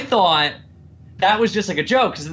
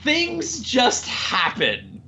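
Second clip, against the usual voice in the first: much higher, slow and drawn out; slow exasperated; 8 semitones higher, 4 dB quieter, much slower than usual. Real, not acted. Complaining about the movie's plot, higher slow exasperation. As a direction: drag out "things happen" in exasperation.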